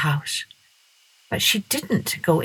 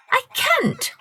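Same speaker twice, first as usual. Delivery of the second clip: put-on much higher child's voice; little girl. A narrator's reading. Masha answering Peter Cockerel, high. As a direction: plead like a small girl.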